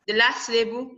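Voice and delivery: usual speaking voice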